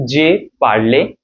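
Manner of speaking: "J'ai parlé" with "jay" and a final "lay"; past tense pronunciation. Shown as a demonstration. Teacher showing learners past tense, sounded final é in "parlé". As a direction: say "J'ai parlé" slowly, both ending in A sounds. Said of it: "the phonetical difference we can see".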